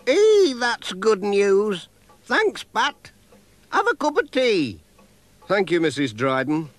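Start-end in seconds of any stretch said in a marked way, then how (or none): 0.1-4.8 put-on old lady voice. A narrator's reading. Same old lady, pleased.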